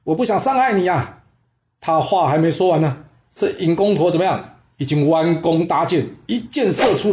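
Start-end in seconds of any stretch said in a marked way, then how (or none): 0.0-1.2 high-pitched called-out warning; shouted warning. Acted. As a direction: call out to send the youngster back. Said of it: "这公孙丁大声喊着说". (高声喊话劝退)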